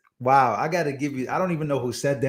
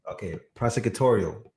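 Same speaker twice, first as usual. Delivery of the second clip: ordinary talk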